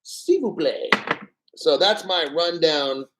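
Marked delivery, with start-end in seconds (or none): 0.0-0.9 a playful hint of a French accent to sum up the cognac; mock French accent. Host comparing cognacs to viewers, in a mock French accent. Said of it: "Whereas this is".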